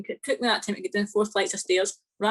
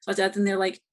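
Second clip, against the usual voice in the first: ordinary talk, nothing marked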